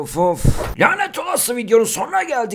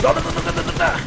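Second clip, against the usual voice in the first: gun noises; 9 semitones higher than usual. Pretend. Imitating gunfire.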